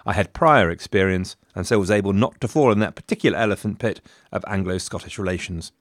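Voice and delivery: usual speaking voice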